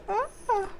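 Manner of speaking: high, small squeal of frustration; mock frustrated scream. Worker made furious by customers, small frustrated squeal.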